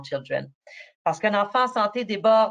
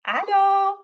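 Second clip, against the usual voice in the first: high sing-song hello; 13 semitones higher, 6 dB louder, much slower than usual. Real, not acted. Greeting sick child on camera.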